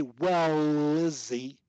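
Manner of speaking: "Well, Izzy" with a long held L bridging both words; connected as one word. Shown as a demonstration. Coach asking student to repeat, connected as one word. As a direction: draw out "Well" straight into "Izzy". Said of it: "I'm connecting it as one word".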